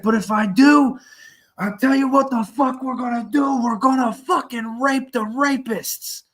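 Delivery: crazed inmate ranting from his cell at night; retold unhinged inmate. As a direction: ham up a crazed cellmate's threat. Retold inmate's loud rant.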